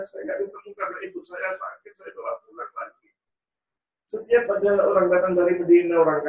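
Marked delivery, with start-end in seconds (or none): 0.0-3.1 crying whisper. Acted. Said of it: "orang ini nangis terus".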